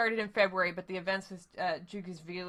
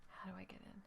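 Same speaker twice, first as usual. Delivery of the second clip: whisper; 20 dB quieter, faster than usual. Acted. Player character asks quietly.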